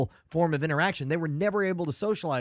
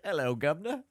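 mock Cockney. Mock English greeting.

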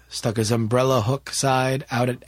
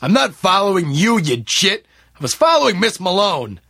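louder, higher, mildly affronted retort; indignant protest; 7 semitones higher, 6 dB louder than usual. Acted. David correcting the man who tripped him, mildly indignant. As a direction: push back at your accuser.

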